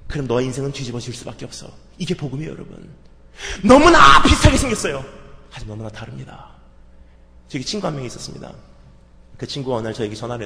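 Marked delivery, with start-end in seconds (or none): 3.6-5.0 loud, high emphatic shout on 너무나; ringing preacher's shout. Real, not acted. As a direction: cry it out to the room.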